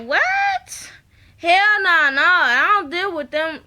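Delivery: high-pitched "what", then loud refusal; imitating someone loudly balking at the news; shocked refusal. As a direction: act out an incredulous, indignant comeback. Acting out [name]'s shocked refusal.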